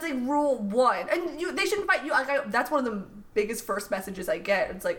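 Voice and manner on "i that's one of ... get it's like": ordinary talk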